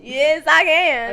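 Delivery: loud, slow Australian; Australian accent. Shown as a demonstration. Insisting they can do it, in loud Australian. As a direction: stretch it out in a put-on Aussie accent. Keeps boasting in put-on accent.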